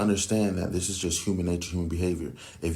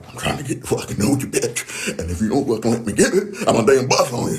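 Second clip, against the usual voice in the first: higher, louder, fast and threatening; menacing aggressive version; 4 semitones higher, 8 dB louder than usual. Retold or imitated. How she hears the man's approach, loud aggressive threat. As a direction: bark it at her like a predator. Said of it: "It's more like".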